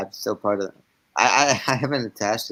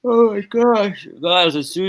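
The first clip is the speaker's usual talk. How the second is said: yawning mid-sentence; 9 semitones higher, 7 dB louder, slower than usual. Real, not acted. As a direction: let a big yawn stretch the words. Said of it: "now I can't stop yawning".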